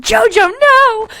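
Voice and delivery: high, loud, slow scream of a name. Acted. Aunt screaming as he jumps into the hole. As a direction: cry out in despair. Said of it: "Auntie screamed".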